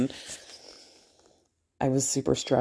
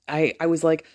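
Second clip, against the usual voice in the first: ordinary talk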